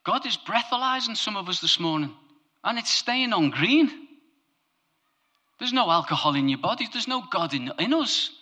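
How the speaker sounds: high, loud preaching build; full-force sermon voice; impassioned preaching. Acted. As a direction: preach it out with fire.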